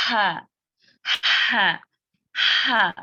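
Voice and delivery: breathy ha sound with open flowing breath; demo of ha sound. Shown as a demonstration. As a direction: let the breath run on ہا. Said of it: "جیسے کہ". (ہا کی چلتی ہوئی آواز)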